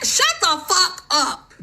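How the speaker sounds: slow, drawn-out, high Shut the fuck up with each word hit; slow, high, seething repeat; slow emphatic retort. Real, not acted. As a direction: stretch every word out, high and hard. Telling off critics again.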